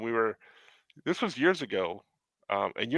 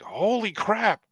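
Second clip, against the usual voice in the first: higher, louder, slow amazed recognition; excited exclamation; 8 semitones higher, 6 dB louder, much slower than usual. Retold or imitated. Fan spotting George Lucas at the park, excited. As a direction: gasp it out, starstruck.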